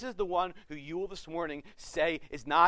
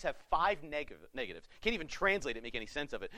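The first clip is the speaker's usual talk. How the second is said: ordinary talk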